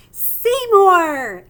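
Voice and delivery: loud joyful call from afar. Acted. Little girl finding her lost hamster, loud happy call. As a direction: shout his name across the house.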